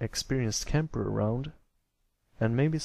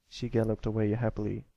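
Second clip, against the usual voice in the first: ordinary delivery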